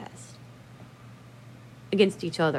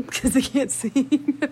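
slow, higher, breaking into laughter; laughing; 6 semitones higher, much slower than usual. Real, not acted. Joking that they can't sing, laughing. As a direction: crack up at your own joke.

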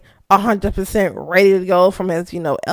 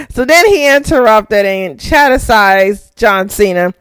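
normal talking voice

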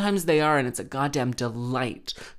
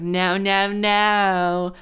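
higher, louder warning no; mock chorus of no; 5 semitones higher, 5 dB louder, much slower than usual. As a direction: imitate the crowd warning the boy. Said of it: "everyone's like".

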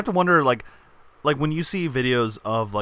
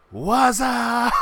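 high, drawn-out goofy shout; whooping, stretched-out greeting; mock phone holler; 11 semitones higher, 4 dB louder than usual. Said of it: "here's how that phone call went".

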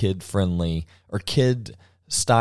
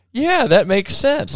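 put-on high, slow kid's voice; childlike agreement; 13 semitones higher, 4 dB louder, slower than usual. As a kid hearing the mouse, high and slowed. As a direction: nod along like a wide-eyed kid. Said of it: "I'm going".

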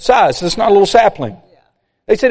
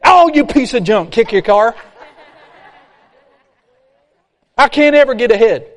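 mock frustrated griping; 3 semitones higher than usual. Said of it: "what a lot of us would do".

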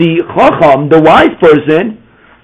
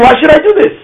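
raised high, defiant question of the wicked son; wicked son's challenge; 10 semitones higher than usual. Retold or imitated. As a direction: throw it out like a rebellious child. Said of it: "He's saying".

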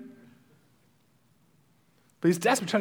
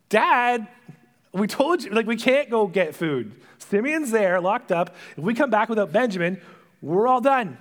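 louder, higher mock protest; exasperated sons; 5 semitones higher, 5 dB louder than usual. Sons to Jacob about getting food, exasperated. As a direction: plead with Dad, voice raised.

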